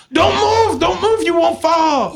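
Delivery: high, urgent yelled warning; mock screaming. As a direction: imitate a panicked woman shrieking orders. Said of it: "she screamed the whole way".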